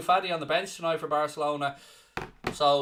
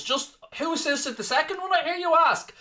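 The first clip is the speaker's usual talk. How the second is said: much higher, teasing showman lilt; mock announcer question; 11 semitones higher than usual. Teasing the chat before naming Lingard, high showman voice. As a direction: build up the reveal theatrically. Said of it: "rhetorical mock question to chat".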